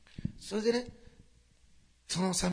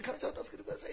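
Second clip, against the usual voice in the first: breathy, high, hopeless cry for help; tragic plea; 13 semitones higher, 5 dB quieter than usual. Pretend. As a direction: beg God in despair, half whispered. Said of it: "こう悲壮感を持って". (悲壮感たっぷりの嘆き声)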